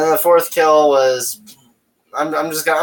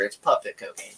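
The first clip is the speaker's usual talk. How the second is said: normal talking voice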